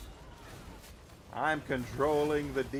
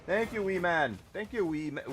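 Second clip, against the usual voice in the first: ordinary talk, nothing marked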